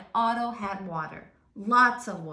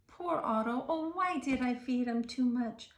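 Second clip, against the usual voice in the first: plain reading voice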